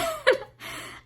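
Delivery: sudden high burst of laughter; laughing. Real, not acted. Reviewer laughing at her Mothra rant, high burst of laughter. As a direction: crack up at your own rant.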